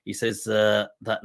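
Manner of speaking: ordinary talk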